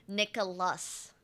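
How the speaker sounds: Nicholas slowly, with the final S sounded; careful pronunciation. Shown as a demonstration. Streamer settling on a name, slow careful Nicholas with S. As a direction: spell out Nicholas by ear, slow to the last S. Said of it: "I've pronounced his name".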